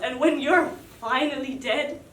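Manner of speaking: usual speaking voice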